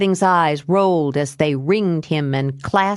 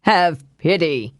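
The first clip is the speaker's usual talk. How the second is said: slow, pleading husband; put-on beseeching man's voice; Evan. A narrator's reading. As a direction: beg her for mercy, drawing it out. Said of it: "he said in Evan's voice".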